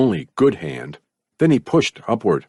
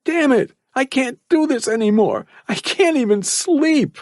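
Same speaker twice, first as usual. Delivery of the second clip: much higher, frustrated cry; frustrated shout. A narrator's reading. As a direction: shout it out, fed up and trapped. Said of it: "he shouted".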